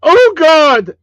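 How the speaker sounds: a high, very loud hammed-up yelp; mock falling scream. Imagining a friend falling down an escalator, hammed-up scream. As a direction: belt out a cartoon cry while tumbling. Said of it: "I just wanna hear the sounds".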